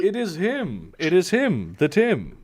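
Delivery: hyped-up, rising welcome; excited. Real, not acted. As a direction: build him up like a legend. Hyping up the guest's arrival.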